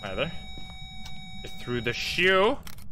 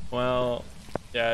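ordinary delivery

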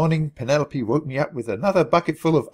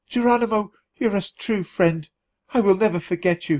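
tearful thanks; 7 semitones higher than usual. A narrator's reading. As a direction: thank him through tears. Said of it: "he cried".

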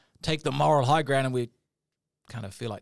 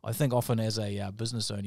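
usual speaking voice

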